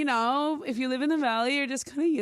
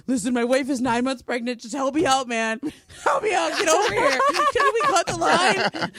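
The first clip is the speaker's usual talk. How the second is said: loud, pleading, higher; pleading shout; 4 semitones higher, 6 dB louder than usual. Retold or imitated. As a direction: beg the repairman loudly. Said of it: "And he was like".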